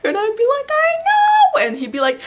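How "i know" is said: very high, loud fake sob; mock hysterical wail. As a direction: shriek back in hammed-up despair. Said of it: "freak out and cry together".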